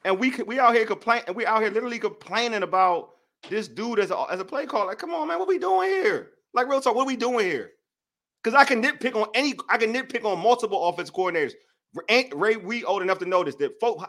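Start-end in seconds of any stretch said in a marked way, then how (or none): 4.9-7.7 exasperated disbelief. Real, not acted. Host to fellow fans, exasperated high-pitched plea. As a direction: pitch jumps way up, pleading and exasperated.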